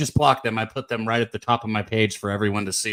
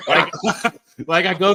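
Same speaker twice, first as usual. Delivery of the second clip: laughing; 5 semitones higher, 6 dB louder than usual. Real, not acted. Laughs recalling fat comment.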